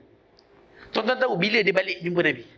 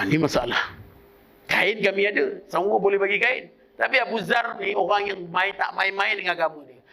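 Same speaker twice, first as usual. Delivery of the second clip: ordinary delivery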